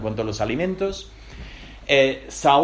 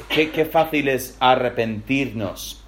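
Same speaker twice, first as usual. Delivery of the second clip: normal talking voice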